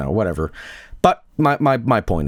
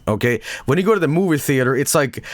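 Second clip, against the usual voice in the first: normal talking voice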